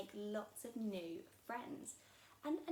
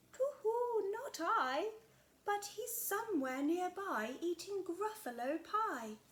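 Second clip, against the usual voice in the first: higher, louder hooting owl voice; a hooty owl teasing the child; 8 semitones higher, 8 dB louder, slower than usual. Acted. Owl answers.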